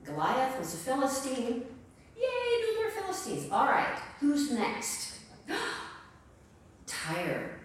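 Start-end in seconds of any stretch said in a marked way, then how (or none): 2.2-3.5 high excited cheer; mock crowd cheer. 5.5-7.6 softer, breathy, lower announcement; hushed gasp. Acted. Gasp announcing next nation.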